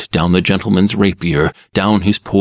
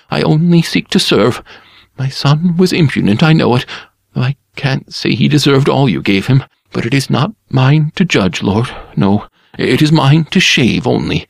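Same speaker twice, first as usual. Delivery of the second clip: higher, humble servant voice; bow and scrape with the voice; servile barber; 7 semitones higher than usual. A narrator's reading. Barber flatters his dangerous customer, servile.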